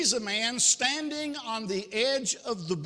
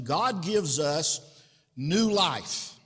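usual speaking voice

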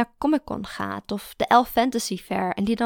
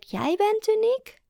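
much higher, slow rising "uniek"; awkward echo; 13 semitones higher, slower than usual. A narrator's reading. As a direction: hand the compliment back awkwardly. Awkward returned compliment. (ongemakkelijk hoog en traag)

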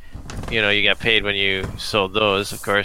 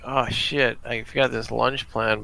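normal talking voice

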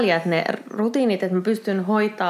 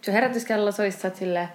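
ordinary talk

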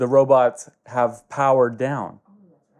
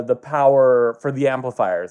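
usual speaking voice

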